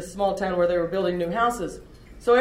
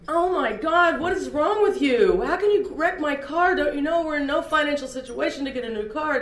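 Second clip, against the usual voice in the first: higher and louder, exasperated scolding; imitating past angry outburst; 7 semitones higher, 3 dB louder than usual. Mother yelling at daughter over wrecked car, exasperated scolding. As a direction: blow up at her like the old days. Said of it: "what I would have said in the past was".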